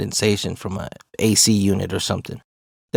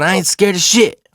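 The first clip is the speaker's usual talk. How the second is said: high, loud denial; indignant acted retort; retold defiant yell; 9 semitones higher, 5 dB louder than usual. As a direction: insist it louder, pitched way up. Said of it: "I'm not yelling anymore".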